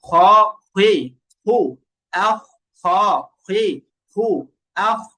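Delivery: slow rounded "খ, হুই, হু, আহ", airy throat kh; heavy kha drill. Shown as a demonstration. Teacher models "like this", slow kha drill. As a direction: space out each heavy kh syllable, lips rounded. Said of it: "এইভাবে".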